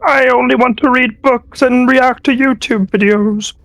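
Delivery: high, croaky little frog voice; bullywug voice. Acted. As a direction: play the frog creature, chirpy and high. DM voices the Bullywug Peaceful Jim.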